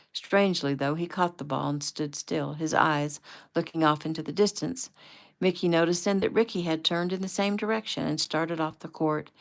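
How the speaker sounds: ordinary delivery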